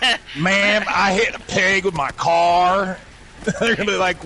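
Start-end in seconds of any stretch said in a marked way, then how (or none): 0.4-3.0 drawn-out, higher-pitched pretend phone confession; mock confession. Caller confesses to an insurance agent, mock confession. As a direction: play a caller owning up, slowly.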